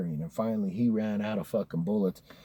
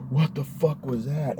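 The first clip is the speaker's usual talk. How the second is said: retold startled reaction, pitched higher; higher-pitched, taken aback by a bang; stunned shock; 5 semitones higher than usual. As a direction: blurt it out in mock shock.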